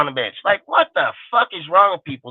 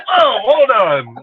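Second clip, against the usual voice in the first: mock hold on; 5 semitones higher, 4 dB louder, much slower than usual. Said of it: "I'm like".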